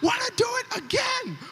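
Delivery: retold burst of hyped shouting. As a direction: yell it out, pumped up. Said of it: "And I was so hyped".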